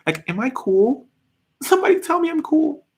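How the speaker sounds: high-pitched mock-needy whine; needy plea. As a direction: beg playfully for approval. Begging chat to call her cool.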